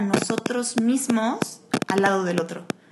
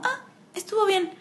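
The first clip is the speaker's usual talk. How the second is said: usual speaking voice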